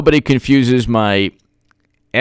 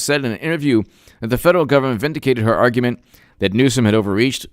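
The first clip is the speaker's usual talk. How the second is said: quick, lower reading-out voice for the article; news article read; 4 semitones lower, faster than usual. Acted. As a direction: read the report briskly, like a news item.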